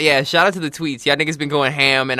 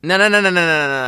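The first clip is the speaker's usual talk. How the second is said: higher, louder hurried no's; loud protest; 4 semitones higher, 4 dB louder than usual. Real, not acted. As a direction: cut them off fast. Stopping the reveal.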